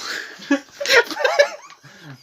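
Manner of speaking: high shrieking laugh; burst of laughter. Real, not acted. Friends hear the silly title, high burst of laughter. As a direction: let out a high cackle.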